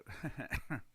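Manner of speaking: short laugh at himself; self-amused chuckle. Real, not acted. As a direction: chuckle at your own joke. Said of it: "I'm laughing at myself now".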